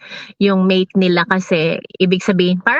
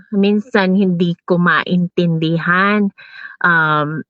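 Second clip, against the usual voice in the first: ordinary talk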